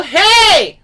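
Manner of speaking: loud, high ringing call; room-filling holler; smiling yell. Shown as a demonstration. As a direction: smile and belt it out forward. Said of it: "put your smile on".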